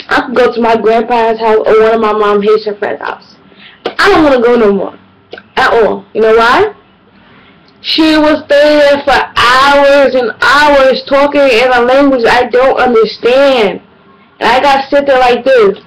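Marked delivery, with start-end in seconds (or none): none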